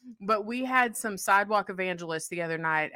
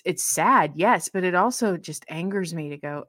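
ordinary talk

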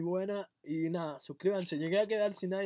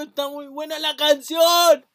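excited shout; 6 semitones higher, 11 dB louder than usual. Real, not acted. Overwhelmed reaction.

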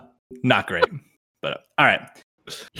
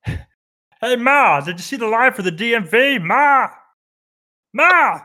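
mock Ray Romano yelling for his mother; Ray Romano whining; 7 semitones higher than usual. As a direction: whine at the DMV line. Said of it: "I gotta get my Ray Romano".

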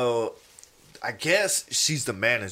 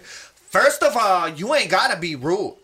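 higher, louder sassy manager rebuke; imitated indignant telling-off; 5 semitones higher, 4 dB louder than usual. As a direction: put a customer in his place, drawing out the opening. Voicing manager scolding rude customer.

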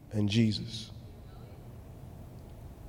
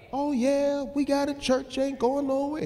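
mock crowd reply; 11 semitones higher than usual.

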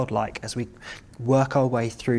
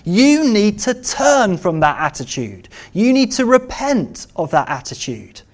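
raised, impassioned preaching, higher and louder; full-force preaching; 8 semitones higher, 5 dB louder than usual. Acted. Preacher urging the congregation to repent, impassioned. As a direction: build it to a passionate plea.

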